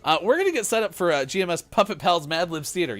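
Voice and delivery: usual speaking voice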